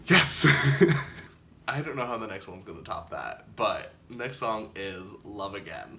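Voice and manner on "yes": loud joyful yes; excited shout. Real, not acted. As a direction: shout it out with joy. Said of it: "jump out of my seat".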